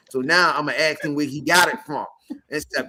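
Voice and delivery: ordinary talk, nothing marked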